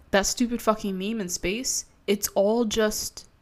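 ordinary delivery

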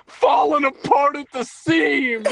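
high, slow laughing yell; laughing shout. Real, not acted. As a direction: shout it while cracking up. Laughing at chaos.

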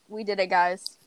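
ordinary delivery

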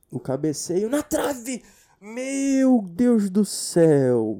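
loud, slow, stretched-out outcry; mock commentator excitement. Mimics the biased narrator's loud near-miss cry. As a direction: shout it like a near miss on radio. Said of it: "mocking the commentator".

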